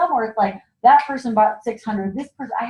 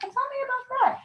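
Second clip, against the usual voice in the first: high, soft, interested guest voice; mock curious guest; 6 dB quieter, faster than usual. Consultant mimicking a guest asking about payment plans, high. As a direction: lightly play the intrigued customer, pitched up. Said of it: "they're like".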